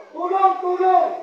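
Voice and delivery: high, loud calls for help; falling man cries out again for help; cry for help. Retold or imitated. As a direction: plead loudly from the cliff. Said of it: "Sabi niya gano'n".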